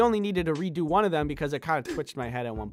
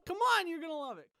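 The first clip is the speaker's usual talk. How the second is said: higher, fast, eager coaxing; pushy hype; 10 semitones higher, 4 dB quieter, faster than usual. Shown as a demonstration. Urging the viewer to try the game, eager and fast. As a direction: sell it hard, all bright pressure. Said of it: "I said".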